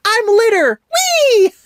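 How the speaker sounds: gleeful high-pitched mock scream; Forky. Reviewer imitating Forky jumping out the window, high mock scream. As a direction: squeal it way up high, hammed-up. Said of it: "he screams".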